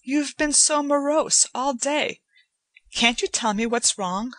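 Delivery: put-on concerned young woman pleading gently; Joanna's voice. A narrator's reading. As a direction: coax him to open up. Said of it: "she said".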